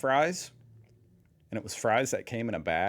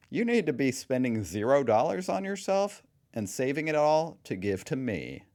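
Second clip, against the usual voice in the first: mock entitled child; 3 semitones higher, 6 dB louder than usual. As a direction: play a kid laying down absurd rules. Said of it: "Hey, Mom and Dad".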